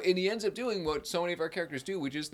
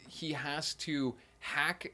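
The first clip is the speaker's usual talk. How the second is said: ordinary delivery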